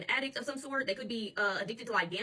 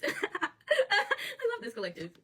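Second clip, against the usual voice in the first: laughing fondness; 10 semitones higher, much slower than usual. Real, not acted. Laughing affection for viewers.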